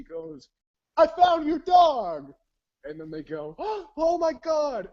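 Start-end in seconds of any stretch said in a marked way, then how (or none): 1.0-2.3 high, slow, loud announcement; jubilant yelling. Retold or imitated. Prank caller acted out. 3.6-4.9 gasp, then high loud cry; freaking out. Retold or imitated. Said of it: "they're freaking out".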